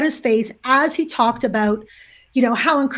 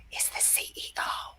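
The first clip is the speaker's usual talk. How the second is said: unvoiced-breath stage whisper of alarm; hushed whisper; 15 dB quieter than usual. Coordinator warning the facilitator about the CEO, hushed whisper. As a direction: whisper it like a risky secret.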